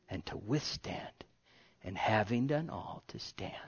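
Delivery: fading down to a whisper; hushed, dying away; 1 whispered close. Real, not acted. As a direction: sink to an awed whisper. Voice drops to whisper.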